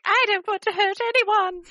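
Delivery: high, pleading character voice; werewolf girl pleading. Acted. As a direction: beg them to stay away. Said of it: "she's like".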